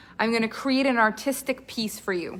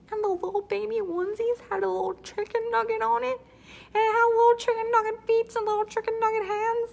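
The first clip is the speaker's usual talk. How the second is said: much higher, cooing excitement; gushing high voice; 11 semitones higher than usual. Real, not acted. Host gushes to viewers about nugget baby clothes, high and cooing. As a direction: coo over the cute idea.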